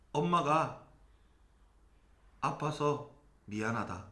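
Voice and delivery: hushed like a mother saying sorry; a hint of a tender mom apologizing to her son; mom's gentle apology. Mother apologizing about her cancer.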